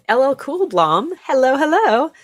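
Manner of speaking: high, loud sing-song hello; playful greeting. Real, not acted. Streamer calling out hello to a chat friend in a loud sing-song. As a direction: greet the chatter with a bright lilt.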